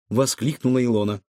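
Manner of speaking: usual speaking voice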